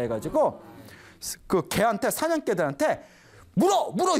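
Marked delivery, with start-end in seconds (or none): 3.5-4.2 loud, higher barked order to the dogs; shouted command. Retold or imitated. Angry hunter shouting at his hunting dogs to attack.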